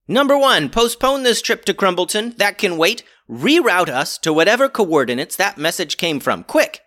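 high, loud commanding captain; urgent orders. Acted.

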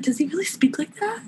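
high, amazed disbelief; incredulous squeak. Retold or imitated. To a friend about his voice memo, high incredulous question. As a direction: gasp it out in disbelief. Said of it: "I was like".

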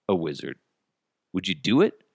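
ordinary talk, nothing marked

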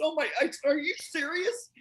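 very high-pitched retold astonishment; thrilled disbelief. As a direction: gasp it out as if dazed by great news. Retells stunned reply to news.

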